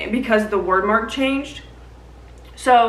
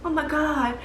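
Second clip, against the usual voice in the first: stunned awe; 4 semitones higher, 7 dB quieter than usual. Retold or imitated. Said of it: "Kale's like".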